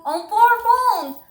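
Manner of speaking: "four phones" hit high, loud and slow; stress on four phones. Real, not acted. Caller stressing to viewers, "four phones", high, loud, slow. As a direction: lean hard on "on four phones" in disbelief. Frustrated at slow police response.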